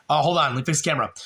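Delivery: ordinary talk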